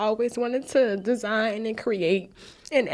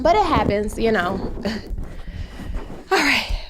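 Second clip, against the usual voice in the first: shaking it off; 6 semitones higher, 5 dB louder than usual. Real, not acted. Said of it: "All right. Back to the goals".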